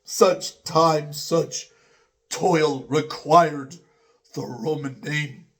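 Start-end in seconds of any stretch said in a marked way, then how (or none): none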